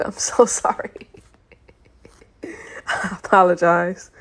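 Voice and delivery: apologizing through breathless, nearly voiceless laughter; words dissolving into laughter; real laughing apology. Real, not acted. Reader apologizing to listeners for botching the book, laughing. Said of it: "I don't know why I'm laughing".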